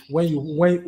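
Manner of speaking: usual speaking voice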